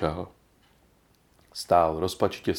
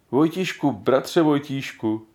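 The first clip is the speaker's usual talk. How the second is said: slow, moved address; 5 semitones higher, slower than usual. A narrator's reading. Old grandfather thanking the boy who saved them, slow and moved. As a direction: say his name slowly, deeply touched. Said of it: "pronášel dojatě starý Trnka".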